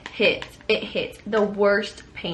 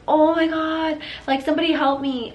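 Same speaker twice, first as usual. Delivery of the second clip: mild mock scream, pitched up; higher-pitched imitation of crying out for help; retold scream; 5 semitones higher than usual. Woman in labor calling for help, mild retold scream. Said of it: "actually screaming".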